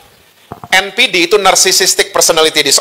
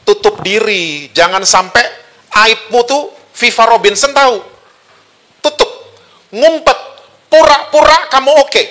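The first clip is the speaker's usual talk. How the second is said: devil's urging; 5 semitones higher than usual. Acted. Preacher as the devil telling Adam and Eve to hide, high coaxing. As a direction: play the devil coaxing, pitched up. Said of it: "Iblis ngomong gini".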